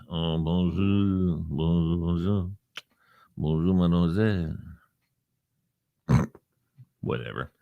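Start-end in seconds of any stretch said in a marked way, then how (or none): 0.1-4.5 French accent. Shown as a demonstration. Host shows off French mimicking to listeners, soft French accent. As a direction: croon it in mock French. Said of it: "You like my mimicking?".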